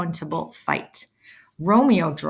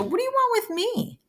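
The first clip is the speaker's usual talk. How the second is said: much higher, mocking put-on voice; mock Tybalt. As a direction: toss it off like a sneering rival. Tybalt's line paraphrased in a voice.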